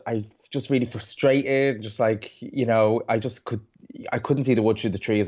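ordinary delivery

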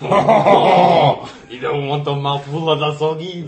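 loud, slow, high French Canadian accent; hammed-up French Canadian drawl. Shown as a demonstration. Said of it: "you gotta say it like a French and Canadian".

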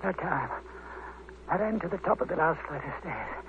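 weak dying voice. Acted. Dying man gasps out his story.

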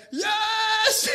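high, loud triumphant yell; screaming yes. Retold or imitated. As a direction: celebrate like you've won the grand final. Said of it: "He's screaming like this".

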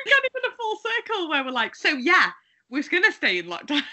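giggling through the words; half-laughing joke. Real, not acted. Joking about choosing lockdown.

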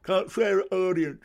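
wag a finger and squawk the rule twice; Charlie Chuck telling off. Retold or imitated. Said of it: "he told me off for swearing".

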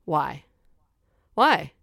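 building to high, loud incredulity; exasperated ranting. Real, not acted. Comic rant about faked accents.

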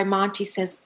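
normal talking voice

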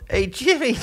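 high, laughing hey; a fan grabbing the comic in a toilet; eager fan. Retold or imitated. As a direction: hail him like an overeager fan. Fan in toilet accosting comedian.

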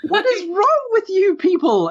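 very high-pitched exasperated squirrel. Acted. Squirrel scolds the strangers over the flicked cigarette, high squirrel voice. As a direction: scold them in a tiny creature's squeak. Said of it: "the squirrel fills up his bucket".

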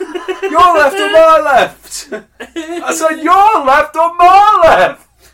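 slow, shouted, hammed-up confusion; loud mock confusion. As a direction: bellow it slowly like a baffled driver. Mock confused driver shouting back.

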